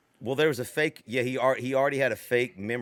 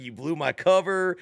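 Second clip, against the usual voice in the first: mild mock yell, a bit higher; lightly raised retelling of a scream; retold scream; 5 semitones higher, 4 dB louder than usual. Host retells Arnold screaming in the chair, mild mock yell. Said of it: "he's screaming".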